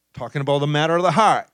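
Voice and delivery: ordinary talk, nothing marked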